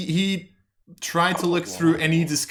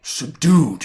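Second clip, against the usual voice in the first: "Subdued" loud, raised and doubting; skeptical NPC echo; 4 semitones higher, 11 dB louder than usual. Acted. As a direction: hit "Subdued" with loud suspicion. NPC echoes player's answer.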